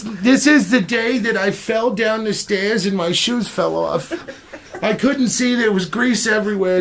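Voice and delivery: high-pitched prank-caller character voice; Saul Rosenberg. Acted. Pretend phone call to John, high comic character voice. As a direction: pitch it up as a hapless man recounting his mishaps. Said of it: "Saul Rosenberg".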